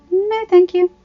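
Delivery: firm refusal. Acted. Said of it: "she replied".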